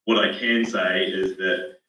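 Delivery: ordinary talk, nothing marked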